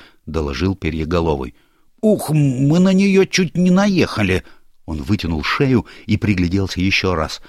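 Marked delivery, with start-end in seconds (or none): 2.0-4.4 high, slow startled cry; startled exclamation. Acted. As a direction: gasp it out, high and alarmed. Gnome nearly hits police car.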